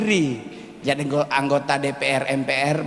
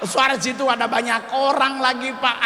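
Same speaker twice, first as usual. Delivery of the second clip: embarrassed comic outburst; 10 semitones higher than usual. Acted. Comedian tells audience of his koteka mishap, panicked high wailing. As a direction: yelp each aduh as if mortified.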